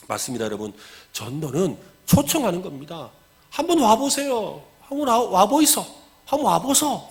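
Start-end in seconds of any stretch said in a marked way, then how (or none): 3.5-7.1 high-pitched Gyeongsang-style invitation; Gyeongsang dialect. Shown as a demonstration. Church member inviting a neighbour, Gyeongsang dialect invitation. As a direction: coax them over with a Gyeongsang lilt. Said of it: "경상도 말로 하면 뭐예요?".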